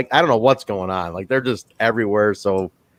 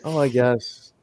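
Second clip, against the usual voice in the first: uninterested and worried; 5 dB quieter than usual. Retold or imitated. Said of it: "uninterested and worried at the same time".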